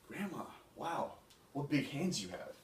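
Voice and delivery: put-on childlike amazement. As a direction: draw out the wow in storybook astonishment. Girl marvels at disguised wolf.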